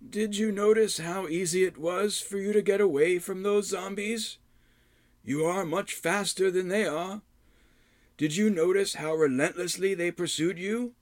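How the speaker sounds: put-on high, sly old hermit; old mentor voice. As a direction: coax him like a wily old mentor. Rydoth explains plan.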